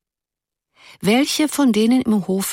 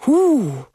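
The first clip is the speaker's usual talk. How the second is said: a high, moved "hu"; little awed gasp; awed exhale; 8 semitones higher, 4 dB louder than usual. A narrator's reading. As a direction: shiver of emotion at the poem. Girl moved by poem.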